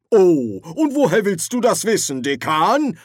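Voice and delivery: very high, loud, sneering question; a wizard sneering at the dean; scornful challenge. Acted. Wizards quarrelling.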